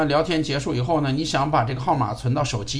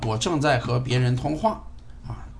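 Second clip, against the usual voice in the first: ordinary talk, nothing marked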